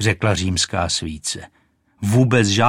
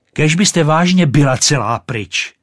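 loud, raised-pitch irritated outburst; shouted; 5 semitones higher, 8 dB louder than usual. A narrator's reading. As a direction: shout it out in exasperation. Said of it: "křiklo bengálské světlo". (podrážděný výkřik)

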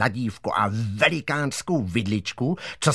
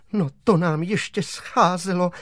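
higher-pitched, exasperated dismay; dismayed mother; 6 semitones higher than usual. Acted. Mother hearing her son is ill, dismayed. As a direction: lament one more trouble.

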